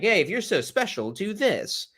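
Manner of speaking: mock dare, needling someone's pride; taunting. Satan taunting Jesus to prove himself, mildly taunting. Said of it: "Satan is taunting Jesus, saying".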